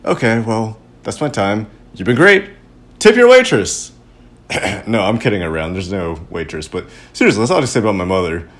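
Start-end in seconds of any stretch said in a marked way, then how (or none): 1.9-3.9 loud, high club-comic send-off; big showbiz closing shout; mock comedian sign-off. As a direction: call it out to the crowd.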